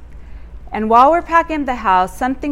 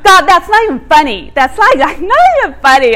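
louder, higher protest through laughter; laughing protest; 6 semitones higher, 9 dB louder than usual. Retold or imitated. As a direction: laugh while objecting to God. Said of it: "And I'm like".

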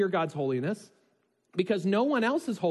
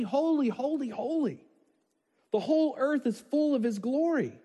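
crying out; 9 semitones higher than usual. Acted. Seraphim crying out again.

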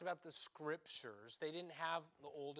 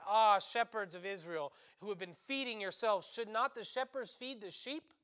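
prophetic rebuke; 5 semitones higher, 8 dB louder than usual. Acted.

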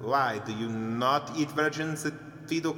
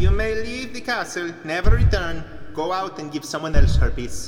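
loud, higher commanding shout; 4 semitones higher, 10 dB louder than usual. Acted. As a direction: bellow him out of the castle. Servant ordering the guest out.

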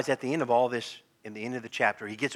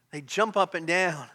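usual speaking voice

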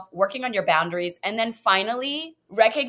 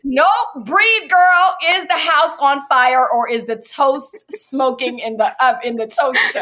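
high, loud, playful self-talk; self-calming pep talk; 5 semitones higher, 6 dB louder than usual. Retold or imitated. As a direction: coach yourself down with a loud, bright girl-to-girl push. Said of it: "I'm like".

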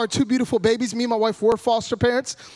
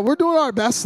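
usual speaking voice

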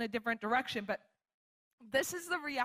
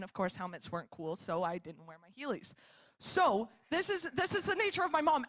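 usual speaking voice

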